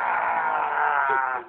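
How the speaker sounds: long low growling roar; monster roar. Shown as a demonstration. Parent showing a child a roar for the camera, long growl.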